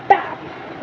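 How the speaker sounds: sharp breathy slap noise; voiced smack of a slap; imitated slap sound. Recalling the man's slap with an imitated smack. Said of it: "ऐसे कस के, बिल्कुल ऐसे गुस्से में".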